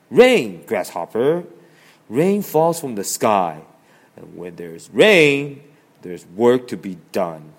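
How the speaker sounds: loud, firm, insistent; stern lecture. Acted. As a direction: lecture him firmly. Ant lecturing Grasshopper sternly.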